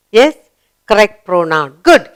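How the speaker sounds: ordinary talk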